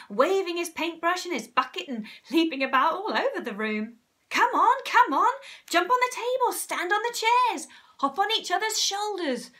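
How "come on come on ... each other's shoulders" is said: high, loud, frantic urging; frenzied excitement. A narrator's reading. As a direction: leap about and hurry them on. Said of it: "in a frenzy of excitement".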